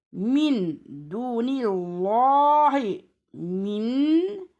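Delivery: "Min dunillahi" chanted slowly with long held vowels; drawn-out recitation. Shown as a demonstration. Teacher giving a last example, "Min dunillahi" chanted long.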